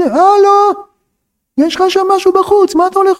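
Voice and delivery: high, indignant call after someone; incredulous calling out. Acted. Teacher calling after the husband leaving, incredulous. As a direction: call him back in high-pitched disbelief.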